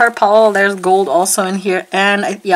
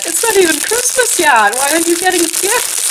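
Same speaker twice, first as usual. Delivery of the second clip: husband quote; 7 semitones higher than usual. Retold or imitated. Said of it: "and he said".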